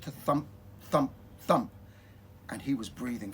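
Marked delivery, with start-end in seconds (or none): none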